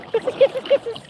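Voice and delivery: high cooing pet voice; sing-song kisses to a pet. Real, not acted. Baby talk to dog.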